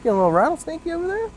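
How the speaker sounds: high, fast teasing sing-song. Real, not acted. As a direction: playfully needle your friend. Teasing the tipsy friend.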